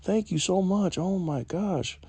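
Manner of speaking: imitated high, grateful gushing; disbelieving gratitude. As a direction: thank them in a high voice, slowing on the last words. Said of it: "she couldn't believe I gave it to her".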